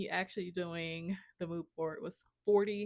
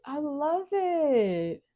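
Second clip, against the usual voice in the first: gushing; 6 semitones higher, 6 dB louder, much slower than usual. Real, not acted. Gushing over the board.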